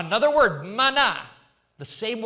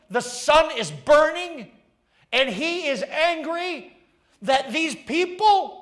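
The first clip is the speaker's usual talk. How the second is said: mounting indignation; 9 semitones higher, 5 dB louder than usual. Acted. Preacher describes Jonah's anger.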